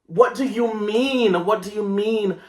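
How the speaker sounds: aghast at the ruined haircut; disbelief. Real, not acted. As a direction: cry out in shocked disbelief.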